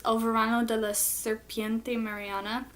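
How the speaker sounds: Spanish title read with an American accent; English speaker reading a Spanish title. Real, not acted. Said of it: "Sorry for my terrible Spanish".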